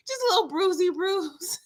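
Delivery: put-on "Just a little bruisey bruise" up high, babyish and teasing. Shown as a demonstration. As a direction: coo it like talking to a toddler. Teasing Brady's small injury.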